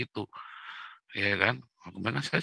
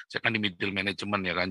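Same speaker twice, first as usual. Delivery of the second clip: ordinary talk, nothing marked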